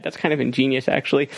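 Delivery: ordinary talk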